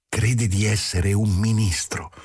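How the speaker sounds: plain reading voice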